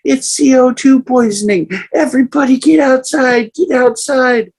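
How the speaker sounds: high, loud urgent shout; urgent warning. Retold or imitated. Sister ordering the family outside, high urgent shout. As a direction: order everyone out of the house. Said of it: "And my sister goes".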